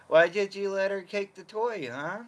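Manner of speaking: high teasing voice to the dog; playful scolding of a pet; teasing pet talk. Real, not acted. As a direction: tease the dog gently.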